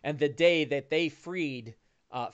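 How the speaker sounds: normal talking voice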